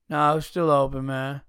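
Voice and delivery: mock listless worker, trailing off; unenthused. Salad guy at closing time answering a customer, unenthused imitation. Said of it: "could you sound any more fucking enthused?".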